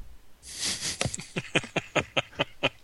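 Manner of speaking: long chuckling laugh; real drawn-out laughter. Real, not acted. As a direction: laugh in a run of short bursts. Laughing at the film question.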